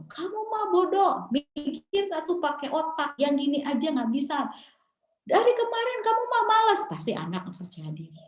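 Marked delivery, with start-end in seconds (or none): 0.1-1.1 high-pitched acted berating; scolding parent. Toxic parent calling child stupid, high scolding. 1.3-6.8 high-pitched acted berating; scolding parent. Toxic parent calling child stupid, high scolding.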